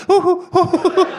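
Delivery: high startled oohs, half laughing; mock startled ooh. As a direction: yelp at cold hands. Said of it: "and I go".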